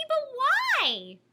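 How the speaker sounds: put-on high, puzzled child's question; puzzled cry. Penelope questioning her aunt, high and puzzled. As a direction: ask it high and bewildered. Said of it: "cried Penelope".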